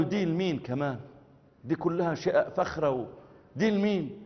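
soft, rising Egyptian wonder; hushed Egyptian surprise; Egyptian amazement. Retold or imitated. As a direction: marvel quietly in Egyptian. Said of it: "أخ مصري".

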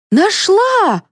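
high, happy cry of a find; delighted exclamation; joyful. A narrator's reading. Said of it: "обрадовалась Людмила". (радостно)

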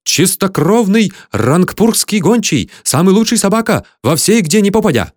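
high, emphatic foreign hawker; shrill squeal. A narrator's reading. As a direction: sing the dog's praises in a high, insistent voice. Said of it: "пронзительно завизжал индус". (пронзительно расхваливать, высоким голосом)

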